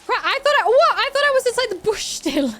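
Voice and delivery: high, loud startled outburst; shocked at being spotted. Real, not acted. Caught out of bush.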